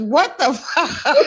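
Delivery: slow laughing what the; retold doctor's shock; mock doctor shock, laughing. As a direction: imitate the stunned doctor, cracking up. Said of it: "they said".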